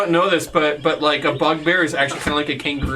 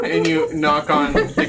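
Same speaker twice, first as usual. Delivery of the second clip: usual speaking voice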